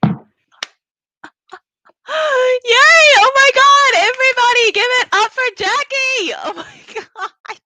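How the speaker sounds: real, very high-pitched cheer; excited cheering. Real, not acted. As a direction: whoop and applaud the performer. Host cheers after the performance.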